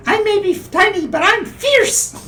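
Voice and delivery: high, slow squeaky boast; tiny fierce halfling. Acted. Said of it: "you hear".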